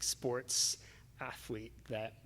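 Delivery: whispered aside; breathy hushed aside. Real, not acted. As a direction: drop to a whisper for the end. Unvoiced aside.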